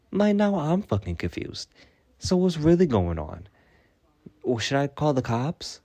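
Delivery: high-pitched, put-on indignant confusion; mock bewilderment. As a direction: play up being baffled, pitched high. Mock escalation over false accusations.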